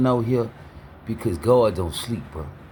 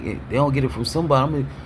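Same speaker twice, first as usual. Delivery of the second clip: put-on old mindset rattled off; much faster than usual. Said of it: "I was programmed to think like".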